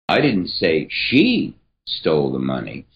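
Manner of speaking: she singled out and stressed; emphasis on "she". Shown as a demonstration. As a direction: point at the word she. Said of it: "emphasis here is going to change every single time".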